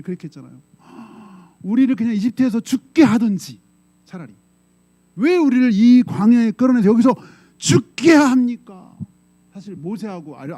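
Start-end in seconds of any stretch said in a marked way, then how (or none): 1.6-9.0 high, loud, bitter complaint slowing at the end; complaining protest. Retold or imitated. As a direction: whine and protest to Moses. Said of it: "무섭게 어떻게 원망했죠?". (이스라엘 백성이 모세에게 높고 크게 원망함)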